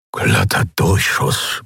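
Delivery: slow, stunned whisper; breathless murmur. A narrator's reading. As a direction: murmur it in disbelief, short of breath. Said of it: "murmelte er".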